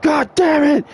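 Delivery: high-pitched mock frustrated yell; upset outburst. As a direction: imitate a furious driver, voice shooting up. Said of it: "She's so upset".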